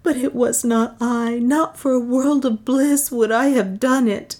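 high, anguished plea of innocence; heartfelt, high-pitched lament; distraught pleading. A narrator's reading. Beatrice desperately pleads her innocence.

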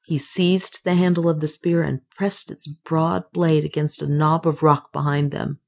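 usual speaking voice

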